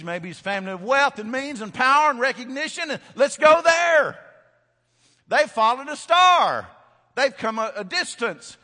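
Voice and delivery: high, shouted, building sermon delivery; full-force preaching. Real, not acted. Preacher telling of wise men entering the city, full-force shout. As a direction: cry it out to the whole congregation.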